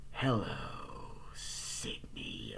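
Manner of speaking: a low, soft, menacing "Hello, Sydney"; creepy caller voice. Retold or imitated. Killer's phone voice, low and soft, to Sydney. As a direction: drag out Sydney like a killer on the line.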